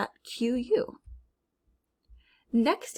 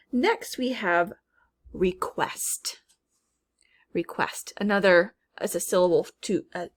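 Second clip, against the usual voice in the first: ordinary talk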